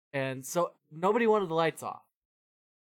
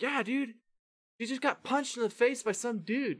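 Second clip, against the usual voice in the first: much higher, worked-up confirmation; agitated insistence; 9 semitones higher than usual. Retold or imitated. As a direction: insist it really happened.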